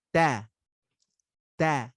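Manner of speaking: ta with no puff of air, close to da; ta said clean, no breath after it; clean Arabic ta. Shown as a demonstration. Teacher shows the right unaspirated ta. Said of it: "But if I say it the right way".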